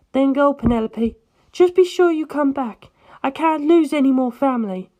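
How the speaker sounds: put-on a light, worried older-sister tone; Erina's voice. Erina lets Penelope go.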